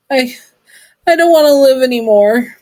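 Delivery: tearful. Acted. Confession while sobbing.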